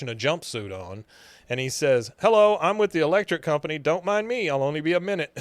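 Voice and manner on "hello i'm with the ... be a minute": higher, quick announcing voice; disguised electrician. Retold or imitated. Man in disguise intruding on a private talk, higher put-on voice. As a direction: breeze in under a silly cover story.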